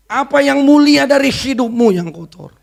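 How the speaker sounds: mock the sinner like the devil; accusing devil. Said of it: "itu iblis". (iblis mengejek dengan suara tinggi dan keras)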